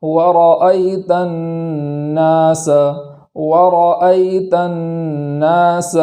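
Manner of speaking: وَرَأَيْتَ النَّاسَ chanted slowly; slow recitation. Shown as a demonstration. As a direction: recite carefully. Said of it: "اس طریقے سے پڑھیں گے".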